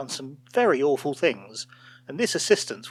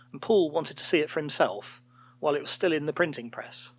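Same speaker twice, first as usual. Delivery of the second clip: usual speaking voice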